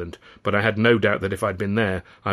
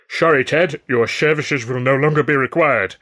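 slurred; 4 semitones higher, 6 dB louder than usual. A narrator's reading. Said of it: "I slurred through my still sore lips and teeth".

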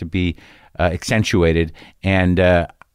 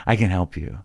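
speech therapist voice; 2 semitones lower, 4 dB quieter than usual. Retold or imitated. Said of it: "if you were a speech therapist".

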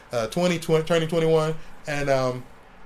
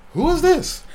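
high, loud, incredulous phone answer; suspicious challenge; 14 semitones higher, 7 dB louder than usual. Retold or imitated. Artist answering an unexpected night call, suspicious. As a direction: demand to know the caller, pitched way up.

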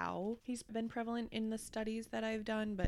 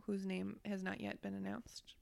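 ordinary delivery

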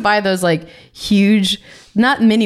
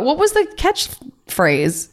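usual speaking voice

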